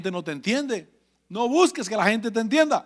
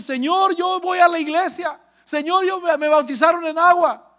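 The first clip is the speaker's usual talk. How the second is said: high, pleading cry to God; high desperate retold plea; 8 semitones higher than usual. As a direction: beg as if being dragged to hell. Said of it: "Y volví a decir".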